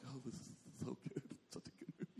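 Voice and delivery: breathy hushed whisper; scared whisper. Retold or imitated. Preacher whispering to his wife during a scary film. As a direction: whisper it as if hiding being scared. Hiding fear, telling wife he likes it.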